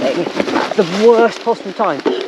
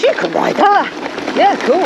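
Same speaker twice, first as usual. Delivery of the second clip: breezy encouraging quote; 11 semitones higher than usual. Retold or imitated. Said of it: "when someone just goes".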